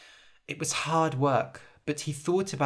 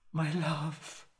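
much softer loving murmur; tender, soft; 7 dB quieter than usual. Acted. Jasper speaks tenderly to wounded Harold, soft murmur. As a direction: speak as to a dying lover. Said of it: "kissing him softly".

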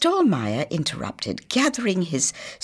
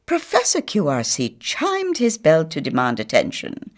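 plain reading voice

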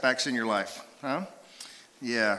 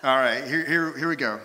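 ordinary talk

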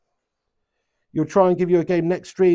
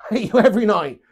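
louder, laughing repeat of the joke; laughing tease; 5 semitones higher, 6 dB louder than usual. Shown as a demonstration. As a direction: tease him through a laugh. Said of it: "why you put an emphasis on tonight".

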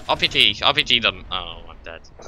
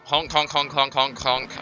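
silly honking; 4 semitones higher than usual. Pretend. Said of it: "I'm honking anyway".

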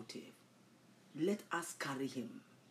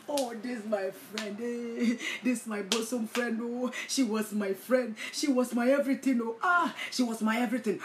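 mock lament; 5 semitones higher, 5 dB louder than usual. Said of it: "you think they are crying".

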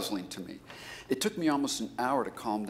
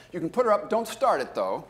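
calling out; 12 semitones higher, 11 dB louder than usual. Real, not acted. Calling out to the tech.

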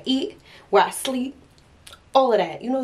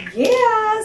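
excited yeah; 6 dB louder than usual. Real, not acted. Excited about going out.

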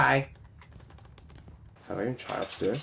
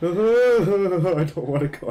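slow, loud, high nervous laugh; 8 semitones higher, 10 dB louder, much slower than usual. Real, not acted. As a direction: laugh it out, scared to move on.

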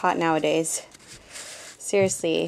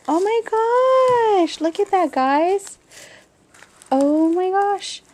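excited squeal; 11 semitones higher, 4 dB louder than usual. Real, not acted. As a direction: coo over something adorable. Gushing over another gift page.

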